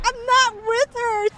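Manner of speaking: high-pitched, drawn-out denial; mock desperate protest. Passenger disowning her seatmate to a family, mock desperate protest. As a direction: squeal a slow, panicked disclaimer.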